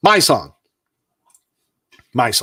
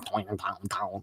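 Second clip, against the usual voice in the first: low, much softer twanging mimic; imitating a mouth harp; 8 semitones lower, 20 dB quieter, slower than usual. Co-host mimics the harp in Join Together, low twanging.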